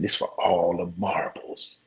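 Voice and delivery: slow, whispered "This for all the marbles"; dramatic hush. Retold or imitated. Son hypes the final race to dad, slow whisper. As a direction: hush it like the big stakes. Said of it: "He said".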